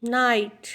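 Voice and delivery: नाइट said alone, its long "eye" vowel held clearly; vowel sound demonstrated. Shown as a demonstration. Teacher showing pupils the vowel sound, नाइट pronounced clearly. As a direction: model the "eye" glide in नाइट. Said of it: "आ की sound दे रहा है".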